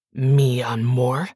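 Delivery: slow, low, sneering mi amor with a rising question; mocking echo. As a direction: throw mi amor back at her in scorn, slow. Miguel mocks her words.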